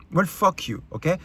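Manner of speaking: ordinary talk, nothing marked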